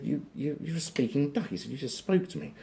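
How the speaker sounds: high-pitched stammering disbelief; acted high-voiced astonishment; astonished barman. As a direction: act amazed, voice pitched right up. Barman amazed at a talking duck.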